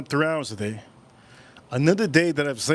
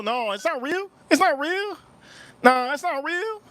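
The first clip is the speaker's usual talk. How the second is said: high denial; 13 semitones higher than usual. Real, not acted. Denying chat's claim emphatically.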